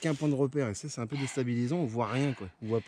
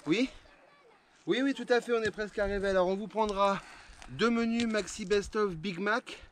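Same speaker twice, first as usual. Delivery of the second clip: fake phone call; 8 semitones higher than usual. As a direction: play-act ordering fast food by phone. Pretend phone order of burgers.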